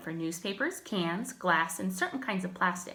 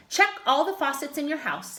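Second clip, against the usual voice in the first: plain reading voice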